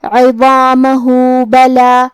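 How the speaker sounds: slow chanted عظامہ بلا with the vowel drawn out; Quran recitation. Shown as a demonstration. Teacher demonstrating madd silah. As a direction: recite it joined, stretching the madd. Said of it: "مد کو ظاہر کریں گے".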